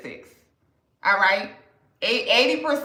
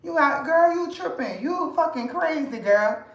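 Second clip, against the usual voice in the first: lightly higher, sassy New Orleans banter between friends; New Orleans girl talk; 5 semitones higher than usual. Shown as a demonstration. A stylist showing viewers how New Orleans friends talk, playful sassy banter. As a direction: show the New Orleans way girls tease each other. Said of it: "the way we like".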